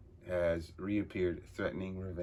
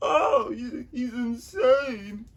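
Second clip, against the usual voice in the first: high, loud squeaky henchman cry; frightened henchman; 9 dB louder, much slower than usual. Acted. Henchman wailing that the boss is mad. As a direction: squeal it like a terrified sidekick.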